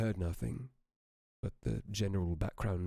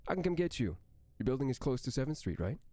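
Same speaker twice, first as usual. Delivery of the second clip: Amy's voice; 6 semitones higher, faster than usual. Acted. Narrator voices Amy on phone.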